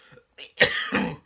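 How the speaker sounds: harsh rising cough; loud cough. Real, not acted. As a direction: cough again, loud and rough. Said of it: "sorry about that".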